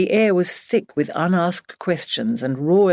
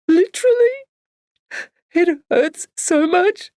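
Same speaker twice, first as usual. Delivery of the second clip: much higher and louder, slow, breaking; sobbing; 14 semitones higher, 4 dB louder than usual. Acted. Barbara collapsing into sobs before Emily, wailing. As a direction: wail it as the tears burst. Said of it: "erupting into a new, stronger bout of sobbing".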